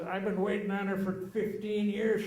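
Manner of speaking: half-joking outrage; mock indignation. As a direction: sound put out and jealous. Indignant coworker.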